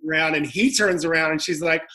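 ordinary delivery

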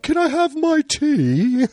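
very high, drawn-out fake British accent; hammed-up British voice, slow and squeaky-high; bad British accent. American mimicking the British asking for tea, silly British accent. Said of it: "Americans do a British accent".

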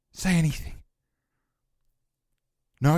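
ordinary talk

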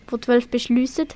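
normal talking voice

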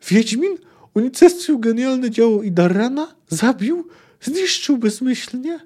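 high-pitched incredulous questions, rising at the ends; thin outraged cry. A narrator's reading. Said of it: "krzyknął nagle cienko".

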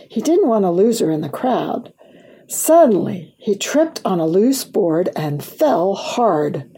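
ordinary delivery, nothing marked

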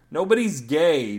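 loud, fed-up yell, slowed. A narrator's reading. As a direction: shout it to end the talk. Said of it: "Daryl yelled".

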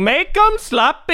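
high, slow celebrity impression; Rob Schneider voice. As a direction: drag it out in a silly high voice. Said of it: "he's like".

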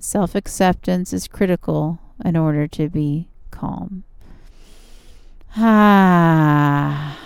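calm and soothing, easing into rest. Acted. Yoga relaxation cool-down.

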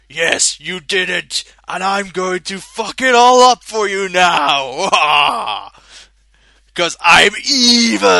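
loud, slow cartoon villain gloating; hammed-up villain shout. The villain crashing Sonic's party, hammed-up evil shout. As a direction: bellow it like a cheesy evil villain.

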